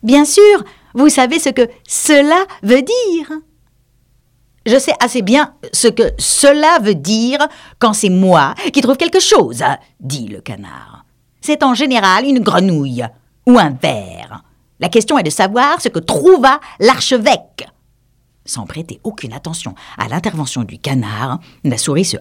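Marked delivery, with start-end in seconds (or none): 0.0-3.3 high mouse, louder and impatient; condescending mouse. Acted. The mouse to the duck, impatient and high. 4.7-17.7 pedantic duck. Acted. Duck argues with mouse.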